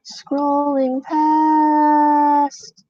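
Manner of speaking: very slow, high, stretched vowels; long held high notes; drawn-out singsong. Real, not acted. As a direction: stretch each word while scrolling. Narrates scrolling in drawn-out singsong.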